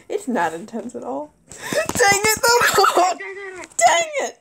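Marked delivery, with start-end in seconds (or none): 1.5-4.4 frustrated shout. Real, not acted. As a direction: yell it at the screen. Got killed in game.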